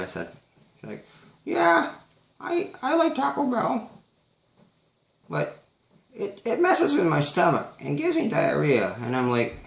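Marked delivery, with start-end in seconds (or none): 1.4-8.9 put-on high old lady voice; chatty old lady oversharing; high-pitched old woman impression.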